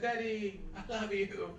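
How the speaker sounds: softly voiced small daughter; little girl's affection. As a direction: say it softly, like a child to Daddy. Little girl speaks to her father.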